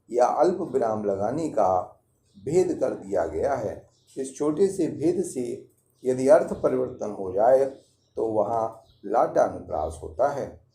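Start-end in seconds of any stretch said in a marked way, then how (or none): none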